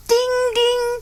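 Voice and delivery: high sung ding like a little bell. A narrator's reading. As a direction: ring it out high and bright. Train bell sound in story.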